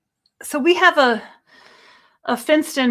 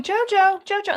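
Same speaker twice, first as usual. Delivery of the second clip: calling out; 9 semitones higher than usual. Retold or imitated. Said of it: "So I'm calling".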